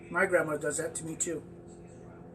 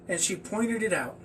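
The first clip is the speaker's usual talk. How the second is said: plain reading voice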